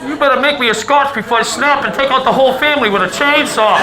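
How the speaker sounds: loud, cursing father yelling downstairs; angry father. Retold or imitated. Dad hunting his wrench on Christmas Eve, loud cursing. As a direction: bellow at the whole house.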